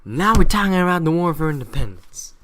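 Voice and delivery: loud, brushing-off announcement; change the subject loudly, dodging; dismissive Americans. Retold or imitated. Americans dodging the slavery question, loud brush-off. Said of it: "the Americans probably went like".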